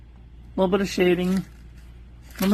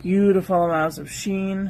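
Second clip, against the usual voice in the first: normal talking voice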